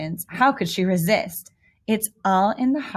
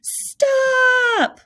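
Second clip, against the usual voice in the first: sudden high, loud shout; parents yelling stop; 14 semitones higher, 5 dB louder than usual. Acted. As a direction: bark the word out like fed-up parents. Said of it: "her parents yelled".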